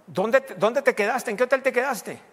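high, loud and insistent questioning; blunt Spanish lady. Retold or imitated. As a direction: press him like a direct Spanish matriarch. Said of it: "Mamá Belén preguntó". (mamá Belén insiste, aguda y fuerte)

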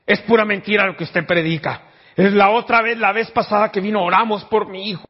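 high, loud bitter accusation; angry accusation. Retold or imitated. Grieving mother accuses the preacher of lying, loud and high. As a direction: hurl it at the preacher like a charge. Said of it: "me dijo".

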